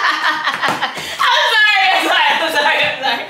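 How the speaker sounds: giggling helplessly; laughing apology. Real, not acted. Reacting to the boyfriend's threat, laughing hard.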